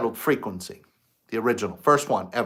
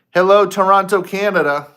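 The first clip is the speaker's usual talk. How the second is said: loud, higher welcome to the crowd; big greeting; 6 semitones higher, 7 dB louder than usual. Real, not acted. Host greets Toronto viewers with a loud call. Greeting the live audience loudly.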